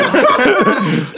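high hearty laugh; loud laugh. Real, not acted. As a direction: burst out laughing. Laughs at own joke.

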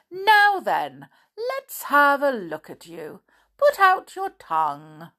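loud doctor, starting high; brisk doctor. Acted. Doctor examines Binkle, loud and brisk. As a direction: take charge as a jolly, loud doctor.

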